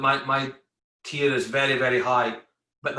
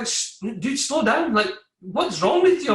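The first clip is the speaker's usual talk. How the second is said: hammed-up coworkers telling him to ease off; retold colleagues, half-shouting; exasperated workmates; 9 semitones higher, 3 dB louder than usual. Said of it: "they're like".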